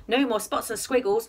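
usual speaking voice

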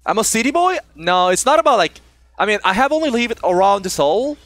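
ordinary delivery

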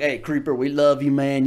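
a hint of a big buff bro hollering; bro fan greeting. Comedian imitating the big fan as he really sounded, bro-style. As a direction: hail him like a rowdy gym bro. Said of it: "He was more like".

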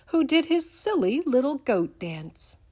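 ordinary delivery

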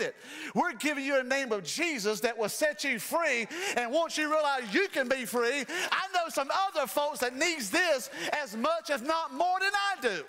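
high, loud preacher's build; climbing, shouting sermon delivery; full-force preaching. Acted. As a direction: preach it out to the congregation.

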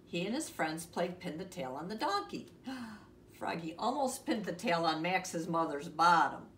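ordinary delivery

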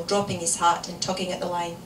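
ordinary delivery

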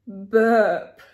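loud burp sound. A narrator's reading. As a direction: do a big burp. Story climax, burp.

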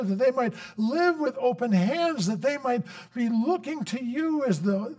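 fervent pastoral prayer building in intensity; closing prayer. Acted. Pastor praying a blessing over the church, fervent and rising. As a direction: pray over the congregation with rising passion.

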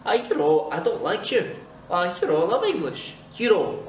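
emphatic Scottish demo, you know as yu-ro; Scottish accent. Shown as a demonstration. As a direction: say it as a Scot, know becomes ro. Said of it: "En écossais, ça se dit you know".

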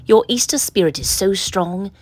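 ordinary delivery

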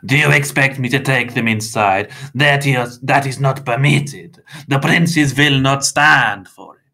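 heated vampire indignation; snapping. A narrator's reading. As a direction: object furiously, hammering each word. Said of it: "Mister Crepsley snapped".

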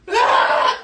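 high shrieking yelp; loud mock wail; mock scream. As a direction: screech like a hurt kid. Imitating the kid screaming again.

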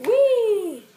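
high happy squeal; gleeful whee. Pretend. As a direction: squeal while sliding in. Toy slides into water.

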